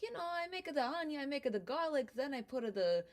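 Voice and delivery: hammed-up Italian grandpa describing his sauce; Italian immigrant accent, fond and proud; Vito explaining. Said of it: "He's like".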